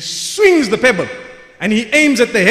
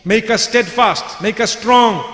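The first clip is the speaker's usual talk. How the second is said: normal talking voice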